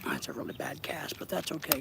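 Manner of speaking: soft whispered aside; hushed muttering at the rod. Real, not acted. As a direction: whisper it to yourself while casting. Muttering while casting.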